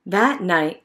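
usual speaking voice

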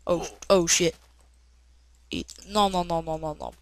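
normal talking voice

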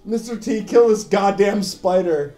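ordinary delivery

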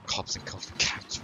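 soft unvoiced whisper. Real, not acted. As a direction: breathe it to yourself. Whispered aside.

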